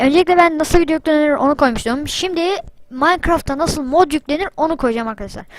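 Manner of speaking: ordinary talk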